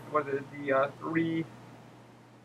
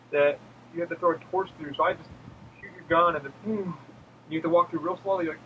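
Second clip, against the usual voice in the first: ordinary talk, nothing marked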